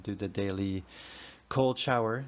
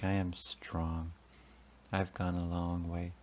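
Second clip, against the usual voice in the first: ordinary delivery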